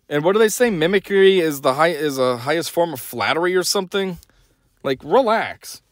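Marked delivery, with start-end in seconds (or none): none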